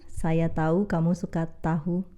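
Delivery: first "tahu" said as tau, last with h; contrasted pronunciations. Shown as a demonstration. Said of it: "can you notice the difference?".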